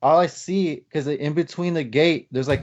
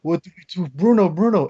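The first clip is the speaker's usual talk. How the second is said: fast, high, panicked calling of Bruno; a brother losing it, words tumbling out; freaking out; 5 semitones higher, 3 dB louder, faster than usual. Retold or imitated. Brother panicking as the dogs fight, frantic. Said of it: "He was freaking out".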